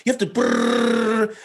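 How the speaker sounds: "you have to brrr" jumps high and slows into a trailing brrr; mocking overcomplicated explainer. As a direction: make "you have to" soar up and drawl, then trill "brrr". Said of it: "complicate, whether through tone or inflection".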